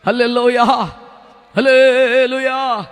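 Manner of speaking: loud, slow, drawn-out hallelujah; preacher leading a loud hallelujah. Real, not acted. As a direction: lead the praise with full force. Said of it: "చెప్పాలి గట్టిగా అందరూ".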